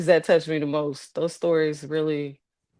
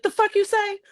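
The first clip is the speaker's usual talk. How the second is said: much higher, incredulous outburst; mock outrage. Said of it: "you wanna be like".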